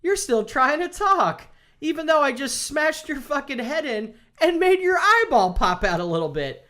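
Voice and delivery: high, mocking villain taunt; mocking Negan. Negan gloating over Glenn's smashed head in a high mock voice. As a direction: sneer it up high like a gloating killer.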